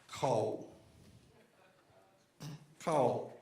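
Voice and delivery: lightly voiced incredulous neighbor, drawn out; impression of Sheik. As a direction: imitate a neighbor slowly breaking startling news. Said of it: "this is a great impression".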